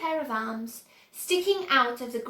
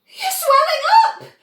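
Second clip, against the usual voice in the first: high, loud panicked scream; 12 dB louder than usual. A narrator's reading. Mother screaming at her swelling daughter, high shriek. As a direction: shriek it in horror. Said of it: "screamed Missus Beauregard again".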